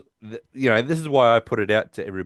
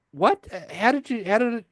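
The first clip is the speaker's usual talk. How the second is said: much higher, startled; mock disbelief at losing out; baffled Barry; 10 semitones higher than usual.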